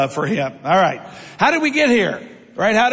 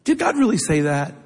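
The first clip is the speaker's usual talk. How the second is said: sly serpent; 3 semitones lower, 3 dB quieter than usual. Acted. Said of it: "He says to Eve".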